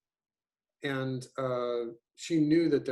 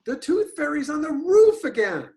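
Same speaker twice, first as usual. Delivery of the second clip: much higher, louder cry of Mom; accented call; 10 dB louder than usual. Shown as a demonstration. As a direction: call out Mom with a big high jump. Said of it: "you start out with an accent".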